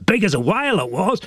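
high, put-on old fisherman voice; high-voiced fish-tale boaster; boastful old Bill. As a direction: brag in a high character voice. Bill tops Ted's claim.

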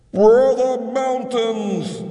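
high, slow call across a distance; calling from afar. Acted. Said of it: "called Maire from afar".

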